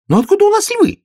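very high, loud bird voice; piping, startled little bird; baffled sparrow. A narrator's reading. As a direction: squeak the question as a sparrow. Sparrow's acted voice.

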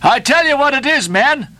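high, rousing call to the men; crying to the crew. A narrator's reading. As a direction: call out to your boat crew. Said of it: "cried Stubb to his crew".

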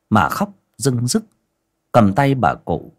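plain reading voice

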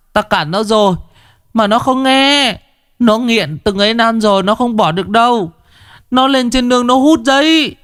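put-on high, far-off wailing old woman, near tears; a frantic wife whimpering for the shaman. A narrator's reading. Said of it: "Người đàn bà mếu máo".

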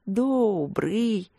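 fervent, drawn-out yes; eager agreement. A narrator's reading. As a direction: agree with warmth and zeal. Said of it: "горячо согласилась Винин".

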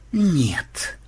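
short, muted refusal; gruffly certain no; confident grumble. A narrator's reading. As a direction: refuse in a near-whispered grunt. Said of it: "уверенно буркнул Ксенобайт".

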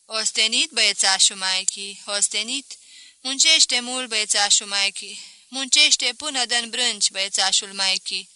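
loud, lightly cooing fuss; doting mother-in-law. A narrator's reading. Mother-in-law strokes her son-in-law's cheek, loud doting fuss. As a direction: fuss over him like a little boy. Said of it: "se aplecă și îi mângâie obrazul".

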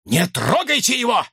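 urgent, high-pitched order; commanding. A narrator's reading. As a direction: order them to keep their hands off. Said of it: "приказал Уоллес". (резкий приказ)

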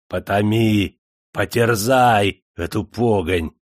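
usual speaking voice

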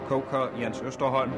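ordinary talk, nothing marked

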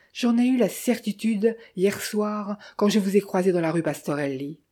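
plain reading voice

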